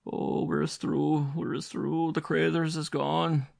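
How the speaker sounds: high, wailing, frightened Irish mother; despairing mother. A narrator's reading. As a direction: lament the lost children.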